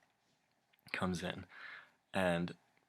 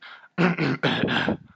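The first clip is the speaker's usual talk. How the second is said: loud hammed-up throat clearing; mock throat clearing; 7 semitones higher, 8 dB louder than usual. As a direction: imitate his nasty throat clearing. Imitating the man's throat clearing.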